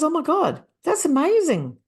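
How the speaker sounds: high-pitched, thrilled exclamation; amazed delight. Retold or imitated. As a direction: imitate a client's happy breakthrough. Client's delighted realization, retold.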